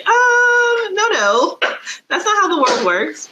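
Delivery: long high hesitant hum, then correcting; high-pitched, politely disbelieving pushback; skeptical objection. Retold or imitated. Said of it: "and you're just all like".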